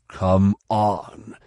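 loud, insistent call; urgent urging. Acted. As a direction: press her forcefully to move. Urging stuck Tahiri again.

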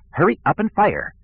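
high, impatient order; impatient director. Acted. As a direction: hurry them along peevishly. Sivron over speakers.

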